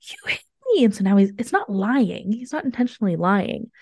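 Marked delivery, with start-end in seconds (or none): 0.0-0.8 put-on much higher child's accusation; imitating toddler. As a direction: mimic the toddler accusing, high. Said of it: "He goes".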